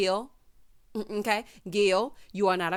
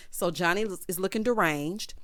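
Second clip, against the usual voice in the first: ordinary talk, nothing marked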